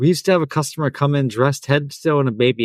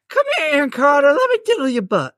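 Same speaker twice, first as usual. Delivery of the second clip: quick, very high falsetto impression; 13 semitones higher than usual. Shown as a demonstration. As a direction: coax in a creepy pop star falsetto.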